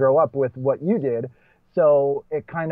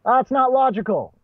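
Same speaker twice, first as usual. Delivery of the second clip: high, raised British heckler voice; British accent heckle; 11 semitones higher, 4 dB louder than usual. Retold or imitated. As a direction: call it out like a posh British heckler. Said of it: "yelled out in a British accent".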